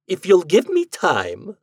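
high, loud, slow exasperation; exasperated. Acted. Half-formed ghost answers the husband, exasperated. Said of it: "in an exasperated voice".

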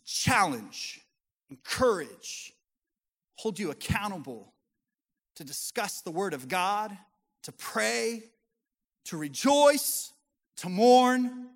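building preacher voice. Acted. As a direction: preach it up to a loud peak.

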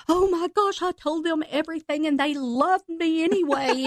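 high, bright burst of joyful news; excited retelling. Retold or imitated. Telling her husband the news, thrilled and high. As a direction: gush the good news up high. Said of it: "I told my husband".

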